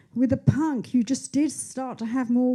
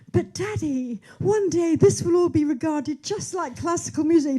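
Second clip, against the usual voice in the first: put-on higher pleading young voice; young daughter pleading; 6 semitones higher than usual. Teen daughter answers her father, lightly pleading. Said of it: "And I would be saying".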